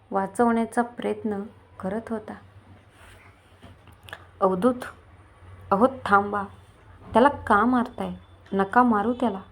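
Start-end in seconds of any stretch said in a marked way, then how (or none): none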